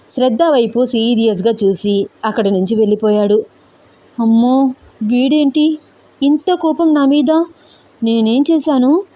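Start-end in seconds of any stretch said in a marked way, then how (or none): 4.2-9.0 higher-pitched, dismayed inner questioning; narrated alarm, drawn out in hesitant little phrases; frightened bewilderment. A narrator's reading. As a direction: wonder anxiously what you did wrong. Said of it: "భయంగా వెంకట్ని చూస్తోంది శ్రద్ధ".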